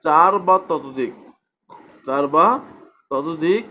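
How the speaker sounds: usual speaking voice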